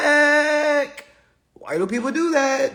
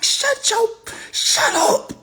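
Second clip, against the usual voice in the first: high, sharp shut up; mock snap; 12 semitones higher, slower than usual. Comic silencing the older coworker, high snap. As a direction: cut him off, pitched up. Snapping at bad advisor.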